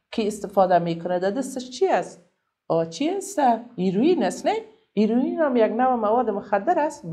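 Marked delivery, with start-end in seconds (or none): none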